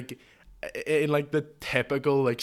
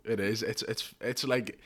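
ordinary delivery

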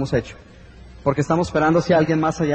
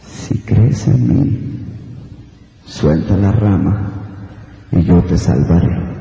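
much lower, slow, booming godlike voice; voice from above; 11 semitones lower, 4 dB louder, much slower than usual. Acted. Voice from above answers the hanging man, deep and slow. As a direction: speak down from the heavens, deep and deliberate. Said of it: "y la voz dice".

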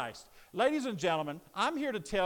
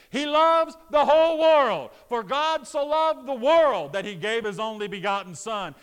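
raised, emphatic pulpit delivery; full-force preaching; 4 semitones higher, 6 dB louder than usual. Real, not acted. Preacher telling the congregation grace is for everybody, full-force preaching. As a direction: preach it out with rousing conviction.

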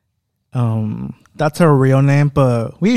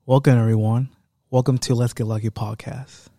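ordinary talk

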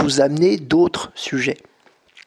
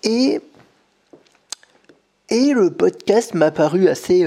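talking through a yawn; words stretched by a yawn; 4 semitones higher, 3 dB louder than usual. Real, not acted. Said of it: "je baille, c'est pas très poli".

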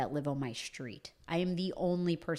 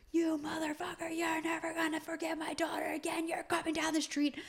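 mock yelling dad; 12 semitones higher, 3 dB quieter than usual. Dad yelling at bus driver, lightly mocked high-voiced rant. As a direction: imitate dad's tirade lightly. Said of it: "I hear him yelling at the bus driver".

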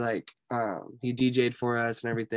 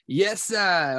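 high, punchy catchphrase; imitated Carlos Culture; 6 semitones higher, 4 dB louder than usual. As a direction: call it out as his signature greeting. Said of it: "he would always be like".

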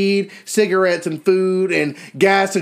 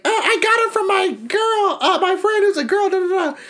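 high, put-on mocking girl voice; high mock voice of the sister; 12 semitones higher than usual. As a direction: squeak it out like a caught teenager. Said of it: "her ass was like".

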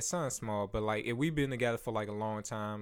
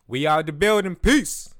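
much higher, louder farewell; loud sign-off; 5 semitones higher, 11 dB louder than usual. Real, not acted. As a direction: shout out the goodbye.

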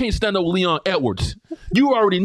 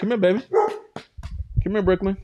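lower, gentle coaxing to a pet; soothing the dog; 8 semitones lower than usual. Real, not acted. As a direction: uncle coaxing the barking dog, gentle and low.

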